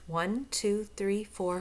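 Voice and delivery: plain reading voice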